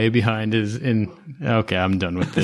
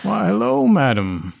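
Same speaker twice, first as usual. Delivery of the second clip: mimicking her voice; 9 semitones higher than usual. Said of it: "echoes the player's voice".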